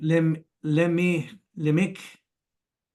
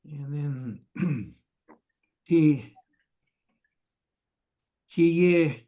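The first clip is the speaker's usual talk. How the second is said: ordinary talk, nothing marked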